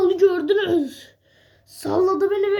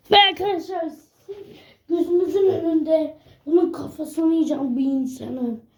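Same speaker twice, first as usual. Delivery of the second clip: usual speaking voice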